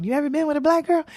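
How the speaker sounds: high-pitched retold call-out; yelled heckle. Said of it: "I yelled out".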